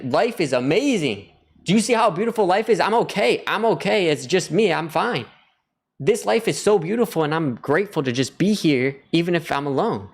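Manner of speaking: high, elated proclamation; exuberant joy. Acted. Telling people outside he's fine alone, overjoyed.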